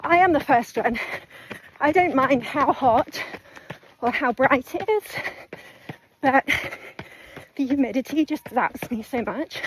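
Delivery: out of breath, talking mid-run; panting between short phrases; while running. Real, not acted. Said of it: "my breathing is just all over the place".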